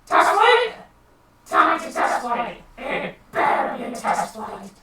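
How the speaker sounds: high squeak dropping to a rasp and back; strained, squeaky mock outrage; little G. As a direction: demand it as an alarmed little boss. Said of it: "little G immediately goes".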